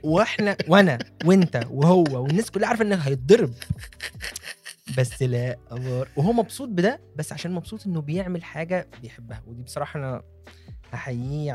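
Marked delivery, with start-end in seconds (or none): none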